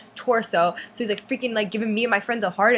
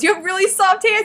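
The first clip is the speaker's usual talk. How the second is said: gushing; 10 semitones higher, 6 dB louder than usual. Retold or imitated. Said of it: "my friend goes".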